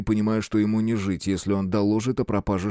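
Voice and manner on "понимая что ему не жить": ordinary delivery, nothing marked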